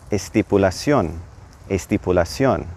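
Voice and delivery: estipulación with the added E, es-tee-poo-la-SYON; estipulación, clear leading E, stress on the final ón; Spanish pronunciation. Shown as a demonstration. Teacher shows the extra E in estipulación. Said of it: "an E appears at the front of it".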